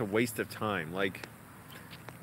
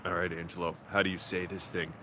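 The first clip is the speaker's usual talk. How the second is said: sullen tutor impression; 7 semitones lower, 5 dB quieter than usual. Tutor quizzing his student on the phone, low and sullen. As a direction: imitate a jerk tutor in a much lower, quieter voice. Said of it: "here's how his voice was the whole time".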